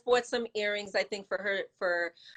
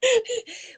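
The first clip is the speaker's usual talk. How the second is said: loud high laugh; 7 dB louder than usual. Real, not acted. Laughs at burp question.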